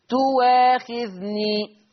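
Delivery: "تواخذني" drawn out with a smooth w; slow recited "تواخذني", no glottal catch; hamza becomes waw. Shown as a demonstration. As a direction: hold the vowels of "تواخذني" after gliding u into waa. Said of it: "فتصير المحصلة".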